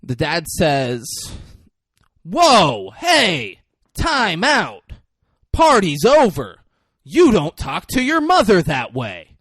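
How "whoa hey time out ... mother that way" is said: louder, higher, slowed commanding yell; loud dad scolding; stern shout. Retold or imitated. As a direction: shut the party down as the dad. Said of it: "Said it just like that".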